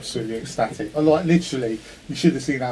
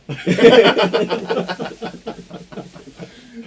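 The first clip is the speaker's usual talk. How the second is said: long laugh, higher and louder; 9 semitones higher, 7 dB louder than usual. Real, not acted. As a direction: laughs at himself, can't stop. Recalling being stuck in the tent.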